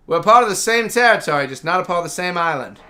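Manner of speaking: a playful imitation of Bernie's accent. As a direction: put on Bernie's accent to set the fact straight. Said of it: "how would Bernie say in her accent".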